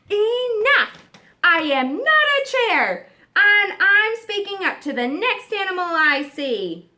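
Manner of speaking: loud, firm declaration; fed up and speaking out; determined shout. Acted. Giraffe finally asserting himself.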